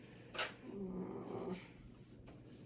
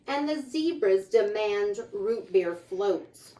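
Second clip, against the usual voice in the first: lively, sing-song picture book reading; reading to children; 4 semitones higher, 10 dB louder than usual. A narrator's reading. Reading a rhyming book aloud to children, lively. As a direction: read the rhymes out brightly to the kids.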